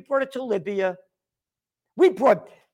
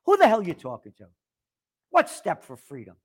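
ordinary delivery

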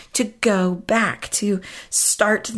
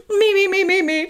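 high, laughing me-me-me warm-up scale; 10 semitones higher than usual. Shown as a demonstration. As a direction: test the croaky voice with a singsong warm-up. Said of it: "I'm working on it".

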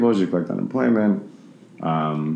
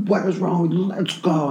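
retold yell at dog; 11 semitones higher than usual. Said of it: "yelled at this one dog".

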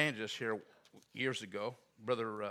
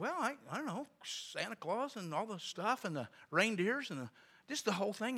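flustered clerk; 8 semitones higher than usual. Retold or imitated. Clerk fumbling for an answer.

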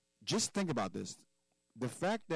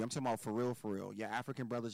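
normal talking voice

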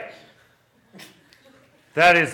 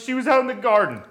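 normal talking voice

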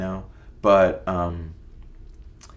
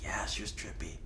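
quiet whispered agreement from the friend; retold whisper; 11 dB quieter than usual. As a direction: whisper it back like a shared secret. Friend Bucky quoted agreeing.

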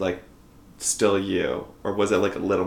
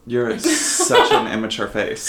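laughing; 14 semitones higher, 3 dB louder, slower than usual. Real, not acted. Teasing the guest, laughing.

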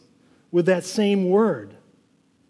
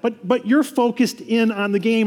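usual speaking voice

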